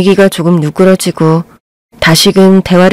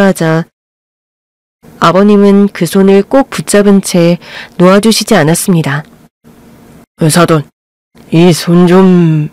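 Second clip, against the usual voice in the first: bow and apologize courteously; the two fathers exchanging formal greetings; polite older fathers; 2 semitones lower than usual. A narrator's reading. Fathers voiced lower, formally.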